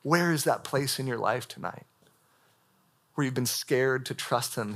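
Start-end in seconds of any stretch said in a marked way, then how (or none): none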